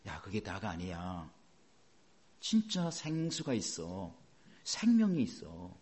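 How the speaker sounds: gentle voice of God. Pretend. God's voice voiced by preacher.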